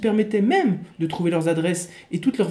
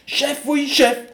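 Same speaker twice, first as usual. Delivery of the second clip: soldier's reply; 7 semitones higher, 4 dB louder, much slower than usual. Acted. Soldier answering chief.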